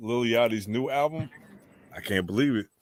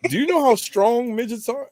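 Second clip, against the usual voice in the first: excited; 13 semitones higher than usual. Real, not acted. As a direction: push it up in disbelief. Excited high disbelief.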